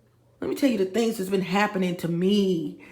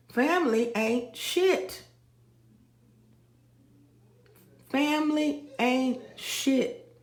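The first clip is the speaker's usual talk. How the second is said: slow emphatic; 4 semitones higher, 5 dB quieter, slower than usual. Acted. Drawn-out slogan repetition.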